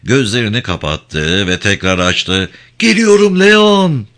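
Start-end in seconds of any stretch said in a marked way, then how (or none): 2.8-4.1 high, loud drawn-out shout. Acted. Crow jumping toward Leon, slow loud high shout. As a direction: stretch the cry out as you leap.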